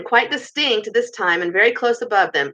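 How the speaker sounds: usual speaking voice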